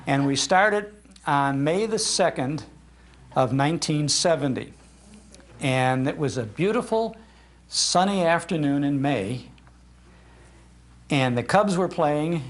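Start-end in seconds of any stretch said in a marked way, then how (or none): none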